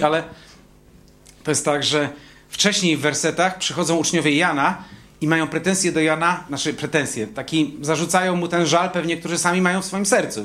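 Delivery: normal talking voice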